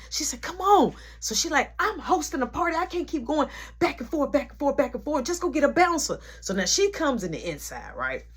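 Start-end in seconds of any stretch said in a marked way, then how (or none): 0.4-6.2 impatient mom. Retold or imitated. Mom scolding, busy hosting.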